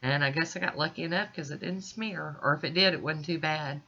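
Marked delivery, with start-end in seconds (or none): none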